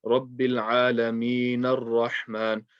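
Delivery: slow, drawn-out "Rabbil Alameen Ar-Rahman" with the n run into the r; joined recitation. Shown as a demonstration. Teacher showing how two ayat join, joined recitation. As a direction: link "Alameen Ar-Rahman" without a break, skipping the alif. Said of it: "you would join the noon to the ra".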